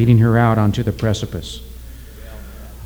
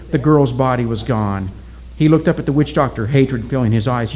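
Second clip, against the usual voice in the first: usual speaking voice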